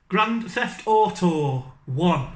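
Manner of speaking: Grand Theft Auto one, slow and drawn out; slow funny reading. Shown as a demonstration. Reading game names to viewers, slow and drawn out. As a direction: drag each word out slowly. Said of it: "in a really funny accent".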